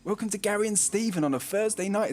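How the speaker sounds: put-on high, singsong children's TV welcome; kids' show presenter. Shown as a demonstration. Streamer trying out the show's intro, in a kids' show presenter voice. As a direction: present it like Saturday kids' telly. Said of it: "like a kids show".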